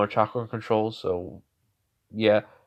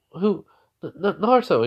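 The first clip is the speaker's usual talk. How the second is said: higher-pitched, startled stammer; 8 semitones higher, 3 dB louder than usual. Retold or imitated. Crying girl caught by Naruto, startled stammer. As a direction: stammer his name in surprise.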